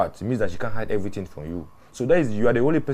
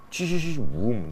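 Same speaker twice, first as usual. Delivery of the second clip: sputtering car start, drawn out; slow vocal car-starting noise; imitated car engine; 3 semitones higher, slower than usual. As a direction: mimic an engine catching with a boom.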